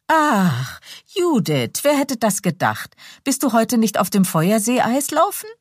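lightly voiced young lady. A narrator's reading. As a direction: feign delight at meeting her. Dorothea's surprised greeting.

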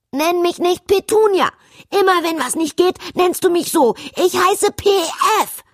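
put-on high, loud furious child's scream, rushing the middle. Princess screaming at her mother not to call her Petunia. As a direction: yell it as a tantrum, refusing the name. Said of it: "schrie P F".

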